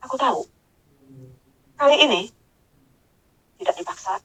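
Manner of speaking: hushed, with long pauses; slow, gentle voice. Retold or imitated. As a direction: imitate a patient unseen voice. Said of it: "suaranya lamba sekali, ada intonasi".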